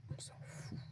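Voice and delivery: breathy whisper of careless relief; whispered aside. Retold or imitated. Card reader whispering the message she hears, carefree. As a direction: whisper it like a cheeky secret.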